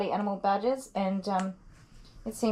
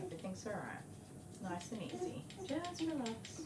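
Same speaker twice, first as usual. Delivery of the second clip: soothing; 16 dB quieter than usual. Real, not acted. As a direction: hush and reassure a squirming pet. Soothing dog while grooming.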